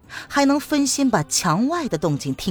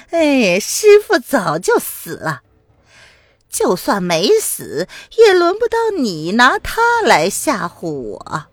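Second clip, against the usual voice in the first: slow, louder taunting villain; sneering old swordsman; 4 semitones higher, 5 dB louder than usual. Acted. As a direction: lord it over her with contempt. 樊胡子 voiced, taunting. (缓慢嘲弄的反派腔)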